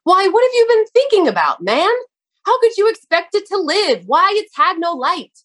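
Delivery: high astonished exclaiming voice; astonished cry. A narrator's reading. Gardener amazed at Hans's dark-kept plant, high cry. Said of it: "cried he".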